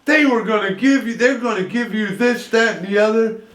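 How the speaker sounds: high-pitched coaxing granddaughter; imitating a daughter pacifying her grandmother; pleading daughter. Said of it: "the daughter gets us off the hook".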